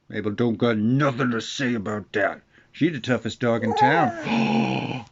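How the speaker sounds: slow tough-dog voice, lightly put on; voicing the dog. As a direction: speak for the dog as a small tough guy. Answers for his dog.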